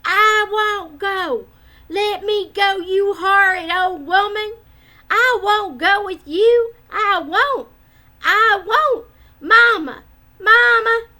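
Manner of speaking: put-on high, loud tantrum wail of a small child; screaming child refusing to budge; toddler bawling. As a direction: howl it like a furious toddler being dragged off. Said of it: "he was bawling at the top of his voice".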